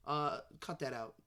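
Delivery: normal talking voice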